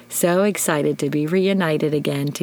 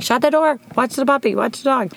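bossy orders; 8 semitones higher than usual. Retold or imitated. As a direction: bark out warnings like a worried mom. Overprotective orders at home.

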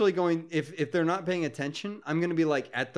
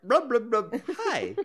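goofy blub voice; 7 semitones higher, slower than usual. Pretend. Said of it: "When have you ever been like".

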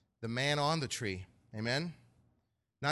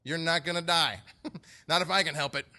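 higher-pitched, insistent refusal; protesting Peter; 3 semitones higher, 5 dB louder than usual. Acted. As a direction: act out a stubborn disciple objecting. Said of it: "Peter talks like this".

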